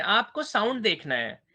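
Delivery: ordinary talk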